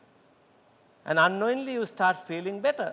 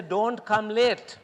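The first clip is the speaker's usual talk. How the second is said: slow, drawn-out "Don't come late"; slow instruction; 2 semitones higher, 4 dB louder, much slower than usual. Shown as a demonstration. Teacher giving a wrong instruction, slow warning. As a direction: stretch it out as a warning. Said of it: "If I say".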